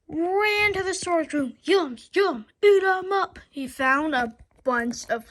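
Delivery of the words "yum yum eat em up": loud greedy monster chant; hungry toilets. Acted. Toilets hunting the hero, loud gobbling chant.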